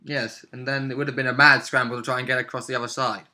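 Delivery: ordinary talk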